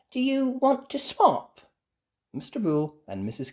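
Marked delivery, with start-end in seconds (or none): 0.1-1.6 high hopeful question; little girl voice. A narrator's reading. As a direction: ask hopefully. Peppa asks.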